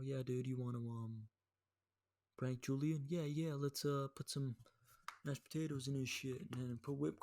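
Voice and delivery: hammed-up casual scheming with hesitant fillers; mock prank planning. Recruiting friends to prank Julian, mock casual plotting.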